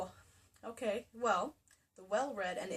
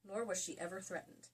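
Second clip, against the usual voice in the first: normal talking voice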